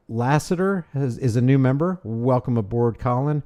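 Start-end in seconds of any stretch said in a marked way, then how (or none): none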